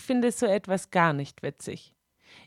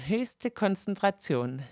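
plain reading voice

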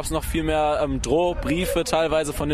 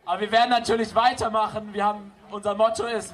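raised, projected address to a crowd; rally speech; 5 semitones higher than usual. Real, not acted. Organizer announces next strikes to the demo crowd, projected speech voice. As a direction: announce plans to the whole square.

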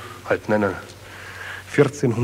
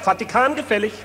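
auctioneer; 10 semitones higher than usual. Acted. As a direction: sell it off like a market crier. Auctioning off the Vatican.

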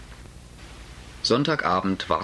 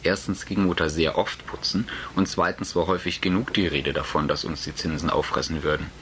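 plain reading voice

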